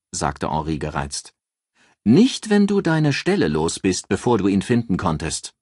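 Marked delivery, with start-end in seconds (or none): 2.0-5.5 higher, louder assured female voice; raised voice for a calculating friend giving advice; Ann, cool strategist. Acted. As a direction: lay out the plan like a cool strategist. Ann countering Henri.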